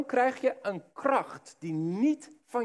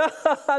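nervous laugh; 9 semitones higher, 6 dB louder than usual. Retold or imitated. Nervous laugh before denial.

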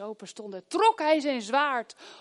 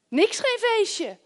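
much higher, slow and drawn out; mock denial; 10 semitones higher, 5 dB louder, much slower than usual. Real, not acted. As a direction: say it with a mocking, stretched high tone. Mocking rhetorical answer.

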